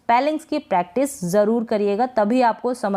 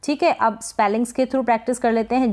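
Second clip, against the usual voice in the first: usual speaking voice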